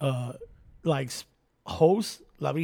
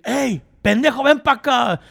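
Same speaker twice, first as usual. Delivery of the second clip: higher, louder mock scolding; angry mom; 7 semitones higher, 6 dB louder than usual. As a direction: call the kid over like a fed-up mother. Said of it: "even when she was pissed".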